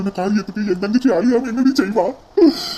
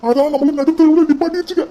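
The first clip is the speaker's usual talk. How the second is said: angry outburst; 7 semitones higher than usual. Acted. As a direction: rage at the family's shame. Brother rages at disgrace.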